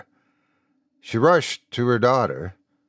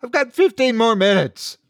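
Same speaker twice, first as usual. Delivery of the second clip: desperate protest; 13 semitones higher, 5 dB louder than usual. A narrator's reading. Father protesting to bailiff.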